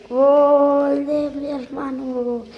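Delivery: long loud goal call; goal commentary. Pretend. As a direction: stretch the goal call like a sportscaster. Calling brother's goal.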